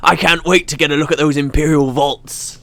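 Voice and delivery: put-on pirate accent gone rustic; pirate accent drifting farmer. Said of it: "turn a little bit farmer when I'm doing a pirate accent".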